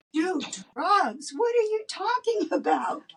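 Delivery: high, baffled disbelief; shocked at the accusation; incredulous protest. Retold or imitated. Woman to the angry couple, high baffled protest.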